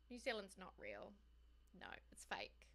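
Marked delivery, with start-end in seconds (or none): 1.8-2.7 much lower, whispered, conspiratorial joke; mock secret whisper. Real, not acted. Joking that New Zealand isn't real.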